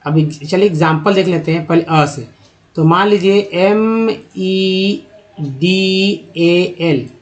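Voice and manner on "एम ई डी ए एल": very slow letter by letter; spelling out letters. Shown as a demonstration. Teacher spells medal slowly for students. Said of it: "इसमें क्या उच्चारण करते हैं".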